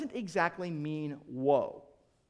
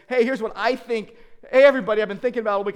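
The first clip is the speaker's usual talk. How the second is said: loud, raised, hailing-the-crowd voice; mock opinionated preacher; 3 semitones higher, 10 dB louder, faster than usual. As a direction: call out like an over-eager preacher. Mimics a preacher sharing own opinion.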